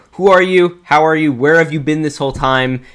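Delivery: ordinary delivery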